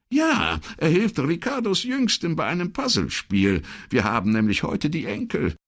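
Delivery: a chatty, friendly wife on the phone; older woman's voice. Acted.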